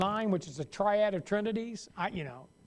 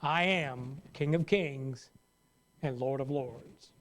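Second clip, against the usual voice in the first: slow, lower, solemn proclamation; voice of God; 5 semitones lower, slower than usual. Acted. Preacher voicing God, slow and solemn. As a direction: declare it slowly as the almighty. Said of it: "That's God stating who I am".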